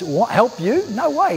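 sharp rising disbelief, No way high; a boy aghast at helping a snake; incredulous refusal. Acted. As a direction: scoff at the idea, pitch jumping up.

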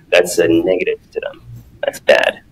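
normal talking voice

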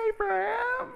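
high squeaky call of the name. Shown as a demonstration. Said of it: "It could sound like".

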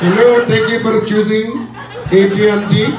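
high, chirpy customer service greeting; a high, sing-song call center welcome. Acted. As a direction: sing it out like a phone rep answering. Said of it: "walk around the house like this".

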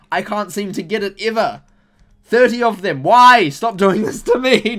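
high, loud exasperated wail; frustrated shout. Real, not acted. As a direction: rage at the bad luck. Streamer raging at bad luck.

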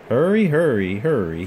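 loud, puffing hurry, hurry; puffing like Gordon. Acted. Thomas pretending to be Gordon, loud and puffing. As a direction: puff it out grandly like a big engine. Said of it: "he puffed, pretending to be like Gordon".

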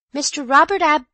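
high, loud formal announcement; announcing maid. Acted. Maid announcing a guest to an empty room, high and loud.

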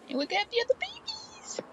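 very high, soft squeak; high whispery squeal. Acted. Pointing out the other babies, high whispery squeal. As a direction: squeal it in a tiny hushed voice. Acted call about the babies.

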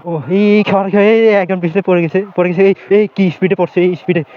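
startled high shout as someone falls; loud, alarmed shout over the water; man down shout. Real, not acted.

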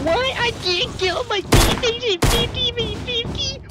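very high-pitched mock tantrum voice; whiny outraged player. Mocking a player who wants a refund, whiny outrage. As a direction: whine it way up high like a cheated gamer. Mimics teammate raging over game rules.

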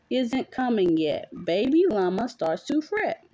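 usual speaking voice

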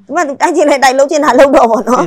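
loud, exasperated retold resolve; retold fed-up declaration, pushed loud; fed-up outburst. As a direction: raise your voice as if washing your hands of it. Retelling own fed-up decision to leave.

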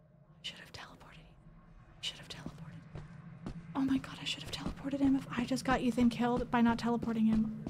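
whispered regret, barely audible; horrified whisper. Real, not acted. Streamer fearing she got Ethan killed, horrified whispering. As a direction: breathe it out as if you just doomed your friend.

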